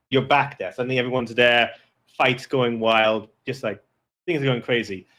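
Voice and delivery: usual speaking voice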